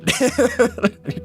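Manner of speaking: forced ha ha into a high nervous laugh; awkward laugh. Retold or imitated. Asked to prove he is no fed, a forced awkward laugh. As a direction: laugh it off uneasily. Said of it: "And I was like".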